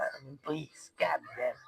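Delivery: whispered please, goddamn, fed up; exasperated whisper. Real, not acted. Ranting about nations, he drops to a weary whisper. Exasperated aside after acted quote.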